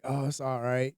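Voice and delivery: quiet, gentle comforting tone; soft reassuring. Retold or imitated. Man comforting a crying woman, softly reassuring. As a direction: soothe her softly. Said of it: "when women cry, it softens me up".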